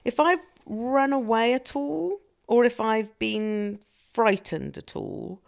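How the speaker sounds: ordinary talk, nothing marked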